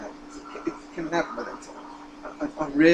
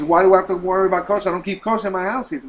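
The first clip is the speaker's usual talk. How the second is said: rapid, louder and higher protest; fast indignant retort; 5 semitones higher, 7 dB louder, much faster than usual. Retold or imitated. Professor snaps back at rabbi, fast loud protest. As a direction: brush off the kosher idea, quickly and loudly.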